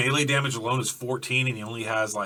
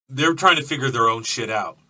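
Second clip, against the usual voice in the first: ordinary talk, nothing marked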